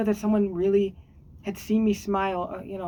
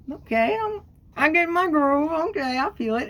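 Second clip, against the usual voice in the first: much higher, bouncy and pleased; happy inner voice; 8 semitones higher, 3 dB louder than usual. Pretend. Acting her mood lifting after a smile, bright excited voice. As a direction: perk way up as the mood lifts.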